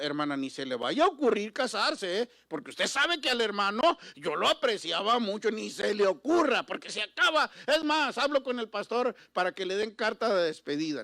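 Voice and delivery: hammed-up nosy church lady scolding a widow; mock busybody church member. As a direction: play the meddling sister warning the widow. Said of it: "hay gente que una hermana queda viuda".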